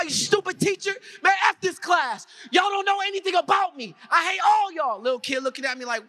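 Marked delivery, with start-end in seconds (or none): 0.0-5.0 high-pitched ranting tantrum; angry child's outburst. Acted. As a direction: lash out at the whole class. Acts out his childhood outburst at teacher.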